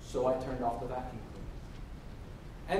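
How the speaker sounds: usual speaking voice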